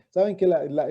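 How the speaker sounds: ordinary talk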